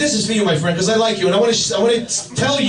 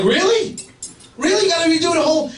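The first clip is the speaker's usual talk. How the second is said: incredulous; 5 semitones higher, faster than usual. Retold or imitated. Disbelief at vet paperwork.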